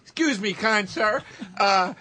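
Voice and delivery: over-polite request. Retold or imitated. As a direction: ask a stranger like a lost, courteous traveler. Acts Patrice asking a driver directions.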